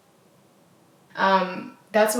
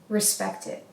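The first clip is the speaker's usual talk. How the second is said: soft whisper; 6 dB quieter than usual. Shown as a demonstration. Coach modelling what to tell a partner, whispered.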